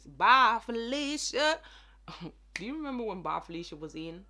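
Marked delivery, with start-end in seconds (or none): 0.0-1.6 loud, higher mock send-off; catchphrase call. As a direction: belt out the catchphrase. Performs an old catchphrase.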